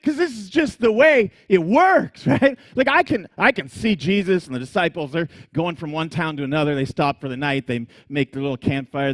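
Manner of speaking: ordinary delivery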